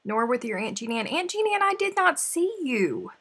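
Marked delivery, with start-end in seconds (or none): 1.1-3.1 high mock voice. Voicing aunt in mock denial.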